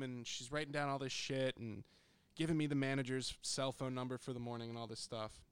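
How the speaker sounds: ordinary delivery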